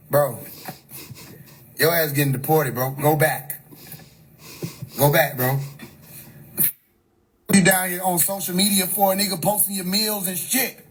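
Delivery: usual speaking voice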